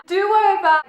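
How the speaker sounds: blurted out high and loud; excited outburst. Real, not acted. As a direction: burst out with sudden excitement. Excited outburst about favourite scene.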